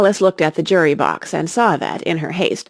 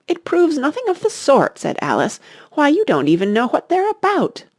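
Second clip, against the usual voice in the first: put-on much higher, indignant girl's voice; indignant protest; 9 semitones higher than usual. Alice protests to the Queen, high and indignant. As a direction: object with shocked disbelief.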